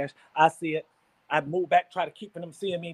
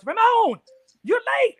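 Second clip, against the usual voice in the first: very high, loud scolding voice; guy at the door; 8 dB louder, slower than usual. Retold or imitated. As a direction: squeak it up high, stern. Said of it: "He goes".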